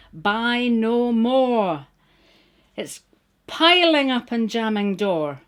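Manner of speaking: rhythmic, wry recital of verse; poem reading. Acted. Reading her clothing poem aloud, wry recital. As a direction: perform the rhymes with a knowing wink.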